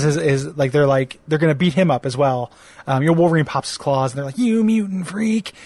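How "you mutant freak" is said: lightly hammed-up heckler, high and drawn-out; mock bully taunt. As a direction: stretch out a pretend jeer, pitched up. Bullies taunting Wolverine, acted out.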